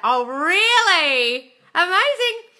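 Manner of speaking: very high, loud and drawn out; gushing surprise. Real, not acted. Vlogger thrilled by a viewer's good news, high and drawn out. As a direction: stretch it out in big delight.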